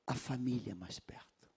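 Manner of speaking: whispered aside, barely voiced. Real, not acted. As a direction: drop to a breathy whisper for the congregation. Pregador sussurra em meio ao sermão.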